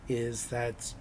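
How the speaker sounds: ordinary talk, nothing marked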